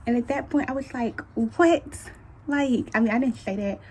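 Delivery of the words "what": higher, louder shocked reaction; startled outburst, played up for the retelling; acted disbelief. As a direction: blurt it in mock shock. Acted reaction to C-section news.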